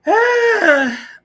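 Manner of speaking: loud pained groan of defeat; exasperated high groan. Real, not acted. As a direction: groan loudly, high and whiny. Forced to upload clip.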